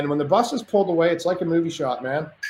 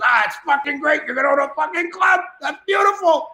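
much higher, shouting, thrilled Greek buddy; tough Greek guy; 12 semitones higher than usual. Retold or imitated. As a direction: whoop it up like an overjoyed tough Greek friend. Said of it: "like, this tough Greek guy".

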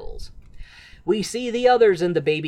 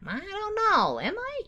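coy Guido voice; 14 semitones higher, 5 dB quieter than usual. Retold or imitated. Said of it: "He's like".

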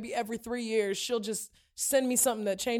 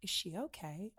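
softer, lower aside; concerned listeners; 5 semitones lower, 13 dB quieter than usual. Retold or imitated. Imitating listeners wondering about her, concerned aside. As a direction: quietly voice a worried onlooker. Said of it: "The listeners are like".

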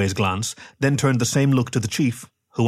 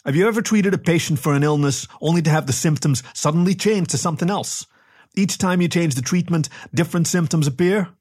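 higher-pitched, lightly voiced captain's question; inquiring captain; 7 semitones higher than usual. A narrator's reading. Captain quizzing the medic about shifting symptoms, lightly voiced inquiry. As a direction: build a leading question, pitched up.

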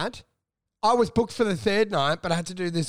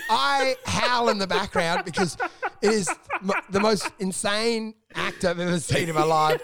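ordinary talk, nothing marked

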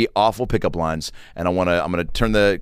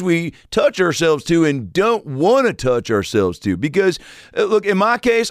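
George Bush Senior impression; 8 semitones higher than usual. George Bush Senior debating why porn is good, much higher. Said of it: "Doctor Phil versus George Bush Senior".

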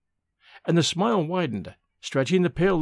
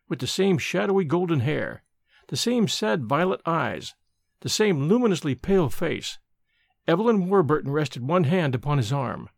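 ordinary delivery, nothing marked

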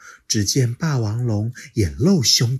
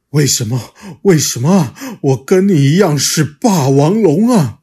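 much louder, desperate dinosaur cry; anguished shout; 7 semitones higher, 11 dB louder than usual. Acted. Little dinosaur crying out at the T-rex, loud and anguished. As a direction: hurl the question at him.

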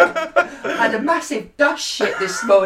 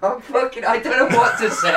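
ordinary talk, nothing marked

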